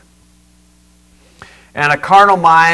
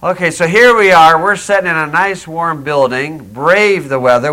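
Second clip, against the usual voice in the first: ordinary delivery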